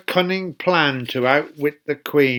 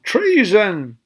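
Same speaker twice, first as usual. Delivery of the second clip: mild mock yell; 4 semitones higher than usual. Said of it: "She stamped her feet and yelled".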